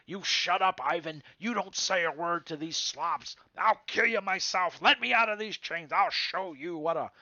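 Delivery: loud, high furious thug threats; yelling at Ivan. Acted. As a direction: bark threats at the cowering prisoner. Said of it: "he's yelling at Ivan".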